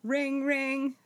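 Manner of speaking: ordinary talk, nothing marked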